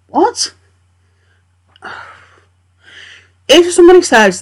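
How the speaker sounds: high, incredulous what; can't believe this; exasperated disbelief. Real, not acted.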